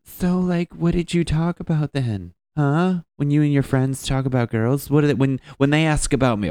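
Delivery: put-on high, needling girlfriend voice; nagging girlfriend. Girlfriend grilling him about his friends, mock needling pitch. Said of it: "I fucking used to get this".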